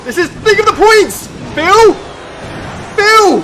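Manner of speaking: loud, high desperate yelling at Phil; frantic pleading yell; pleading shout. Real, not acted. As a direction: beg him at full shout.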